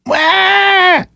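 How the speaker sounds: high loud crying wah; mock baby wail. Mocking the crazies as wailing babies. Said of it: "Let 'em cry themselves out".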